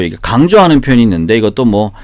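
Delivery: usual speaking voice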